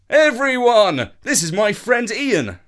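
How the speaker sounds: announcing. A narrator's reading. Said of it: "Uncle Jeff turned and said".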